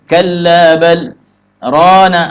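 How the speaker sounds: "kalla bal rana" cut after "bal", no breath; slow "kalla bal", silent catch, then "rana"; brief breathless stop. Shown as a demonstration. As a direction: halt briefly before "rana" without inhaling. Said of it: "Nah, itu dipotong kan?".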